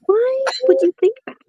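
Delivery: high, cracking disbelief; incredulous. Real, not acted. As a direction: squeak out the disbelief like you can't believe it. Incredulous at the rumour.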